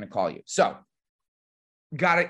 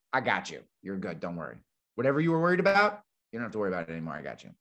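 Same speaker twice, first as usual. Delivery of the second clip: reassuring; 6 semitones lower, faster than usual. Retold or imitated. Guy soothing a scared caller.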